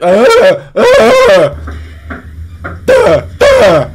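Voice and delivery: hammed-up mindless grunting; mocking a guy at the door as a zombie; zombie grunts. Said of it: "knocking on shit ... like a fucking zombie".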